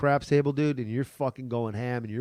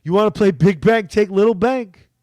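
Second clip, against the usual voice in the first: louder, higher joking challenge; louder, higher, playful dare to a stranger; teasing call; 5 semitones higher, 8 dB louder than usual. Retold or imitated. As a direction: call across the table, ribbing him. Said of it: "And I go".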